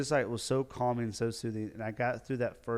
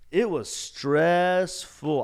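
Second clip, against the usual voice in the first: drawn-out emphasis; 7 semitones higher, 9 dB louder, much slower than usual. Real, not acted. Dramatic recounting of stress.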